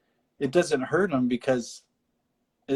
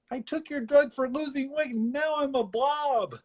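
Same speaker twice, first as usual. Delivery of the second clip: high, whiny lady voice; playful imitation of a townswoman; mock complaining lady; 13 semitones higher than usual. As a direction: complain like an upset customer. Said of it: "this lady".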